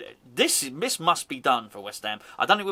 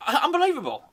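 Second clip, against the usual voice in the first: amazed exclamation; 8 semitones higher, 4 dB louder, faster than usual. Real, not acted. Exclaimed disbelief.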